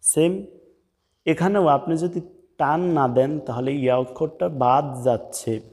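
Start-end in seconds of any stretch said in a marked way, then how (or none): none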